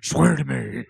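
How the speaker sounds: hammed-up superhero growl; mock-menacing Batman impression, stretched out.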